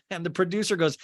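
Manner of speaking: ordinary delivery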